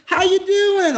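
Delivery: high, held-up imitation voice; Ace. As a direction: greet in a put-on high voice. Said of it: "he goes, he goes".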